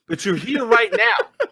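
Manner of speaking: high, slow, laughing through the words. Real, not acted. Streamer to a latecomer in chat, laughing and high. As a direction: giggle it out as you reassure. Laughing while thanking the viewer.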